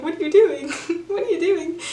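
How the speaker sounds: high-pitched alarm breaking into laughter; mock scared. Chef being stared down by Paddington, comically scared. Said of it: "starts getting scared".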